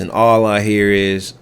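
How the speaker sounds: usual speaking voice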